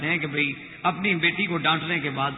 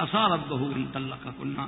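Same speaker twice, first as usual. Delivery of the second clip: Quranic recitation; 7 semitones lower, 5 dB quieter, slower than usual. Acted. Quotes a Quran verse.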